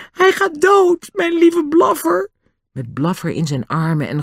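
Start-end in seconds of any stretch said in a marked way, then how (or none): 0.1-2.3 very high, grief-stricken wail; Nobby's choked voice. A narrator's reading. Boy fears his dear dog is dying, choked wail. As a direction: wail it in a strained high voice, slowing at the end. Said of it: "zei Nobby met verstikte stem".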